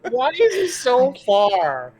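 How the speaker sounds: high, slow whine; Cecil whining. Acted. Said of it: "this is Cecil".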